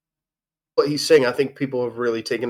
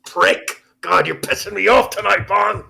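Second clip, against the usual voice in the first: hammed-up angry outburst, higher and louder; mock anger; 8 semitones higher, 6 dB louder than usual. Co-host fakes a loud, high angry outburst at Bong. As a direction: pretend to snap at your co-host, loud and high. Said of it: "I wasn't really popping off on you".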